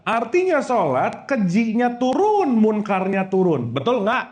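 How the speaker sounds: normal talking voice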